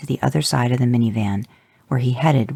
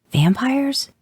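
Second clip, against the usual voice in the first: hesitant question; 13 semitones higher than usual. Acted. Awkward reveal of vampires.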